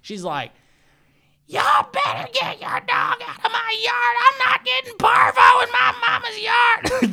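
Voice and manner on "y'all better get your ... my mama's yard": very high, full-volume screeched yell; yelling woman. Retold or imitated. Woman scolding dog owners from her mama's yard, yelling. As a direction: imitate the neighbor yelling, drawn out and high. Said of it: "is yelling at these three people".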